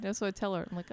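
ordinary talk

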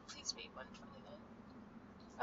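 quick breathy whisper off to the side; whispered aside. Real, not acted. Talking to someone off camera, whispered aside. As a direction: whisper an aside so the viewers barely catch it.

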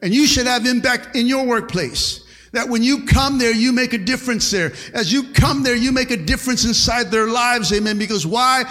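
loud, driving preacher cadence; fiery preaching. Acted. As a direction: preach it out to the whole church. Preaching at full force.

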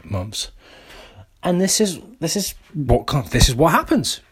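high, hammed-up rant at himself; scolding himself for skipping training, high mock rant; mock self-scolding. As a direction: berate yourself like a fed-up coach, for laughs. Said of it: "I was doing it for comedic effect".

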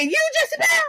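very high squeal of disbelief; shocked disbelief. Retold or imitated. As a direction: shriek it in shock. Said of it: "I said".

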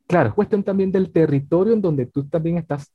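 ordinary talk

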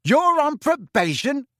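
very high, loud disbelieving question; loud shocked outburst. A narrator's reading. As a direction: blurt it out for everyone to hear. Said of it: "said Ron very loudly".

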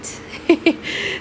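a quick breathy little laugh; soft laugh. Real, not acted. Laughing about her ex while talking to viewers, short soft laugh. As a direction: laugh softly to yourself. Amused at gaining a friend.

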